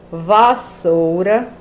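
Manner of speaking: "Vassoura e veja" drawn out at half pace; slow read-aloud. Shown as a demonstration. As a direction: stretch "Vassoura e veja" syllable by syllable. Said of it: "lê estas palavras".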